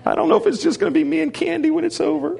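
high, squeaky laughter through the words; laughing hard. Real, not acted. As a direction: talk while cracking up. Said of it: "I'm dying here".

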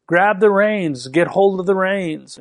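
high-pitched retold yell, not at full volume; imitated sister's yell. Sister calls to runaway rider, imitated high yell. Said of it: "my sister yelling".

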